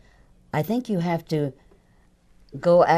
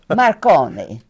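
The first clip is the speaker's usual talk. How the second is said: put-on Italian accent; 3 semitones higher, 5 dB louder than usual. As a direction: play it like an Italian mamma with an Italian accent. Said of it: "Can you do an Italian accent?".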